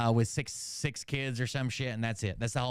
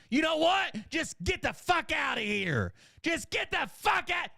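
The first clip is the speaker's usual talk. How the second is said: high-pitched, yelled mock fury; flipping out. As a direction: play a clerk losing it and throwing customers out. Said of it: "just flips out".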